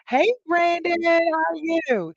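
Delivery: high, drawn-out hello; calling out a greeting. Real, not acted. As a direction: sing out a stretched, friendly greeting. Calls out greeting to viewer.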